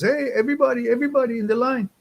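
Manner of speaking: high retold shout to a crowd, fast at first; calling out to the line. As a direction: yell out to everyone queuing for bread. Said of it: "You start yelling".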